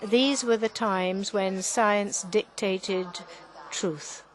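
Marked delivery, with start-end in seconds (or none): none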